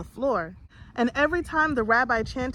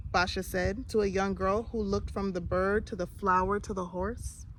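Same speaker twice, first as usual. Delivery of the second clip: plain reading voice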